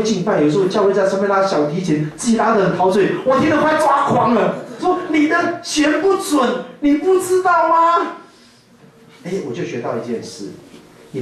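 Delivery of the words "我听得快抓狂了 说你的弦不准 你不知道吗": high, slow exasperated whine; mock exasperated high voice; exasperated complaint. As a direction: whine it out, losing patience. Pastor mocks out-of-tune violinist.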